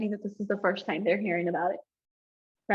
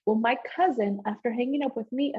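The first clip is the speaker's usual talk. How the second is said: normal talking voice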